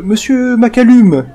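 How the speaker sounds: high, loud excited reporter voice; eager journalist. Acted. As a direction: call out the question like a keen reporter in a crowd. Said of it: "un journaliste qui pose une question et qui dit". (journaliste pressé qui interpelle, voix aiguë et forte)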